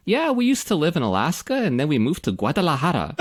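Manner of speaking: put-on high American chatter, then showy Spanish accent on Guadalajara; American with extreme Spanish Guadalajara. Host mimics lady from Alaska, American voice with exaggerated Spanish place name. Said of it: "this extreme Spanish emphasis".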